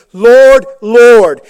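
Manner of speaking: loud, ringing call of Lord, Lord; crying out Lord. Acted. Believer crying out to Jesus, loud call. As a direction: call it out like a desperate plea.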